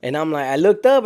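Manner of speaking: ordinary delivery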